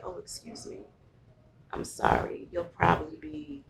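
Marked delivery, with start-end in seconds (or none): 0.0-2.5 hushed apology. Retold or imitated. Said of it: "turn around and say".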